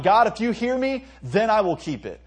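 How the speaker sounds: high, loud, bargaining with God; bargaining cry. Pretend. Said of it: "It's not".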